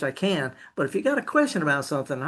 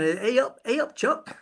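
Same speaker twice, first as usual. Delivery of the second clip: put-on playful northern English accent, pitched up; a hint of Yorkshire, hammed up; mock English greeting; 6 semitones higher than usual. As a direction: tease back with a jokey English accent. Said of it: "With that accent you got from England".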